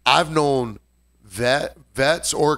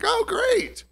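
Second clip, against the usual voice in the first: squeaky, much higher sarcastic oh great; mock delight. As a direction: fake cheer, pitched way up. Sarcastic reaction to fan leaving.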